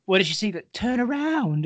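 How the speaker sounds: high mock English accent; an imitated English accent, playful and high. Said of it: "in her, like, English accent".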